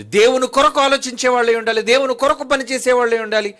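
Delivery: loud, high pulpit exhortation; fiery preaching. Acted. Preacher urging listeners to weep only for God, preaching loudly. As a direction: drive it home like a revival preacher.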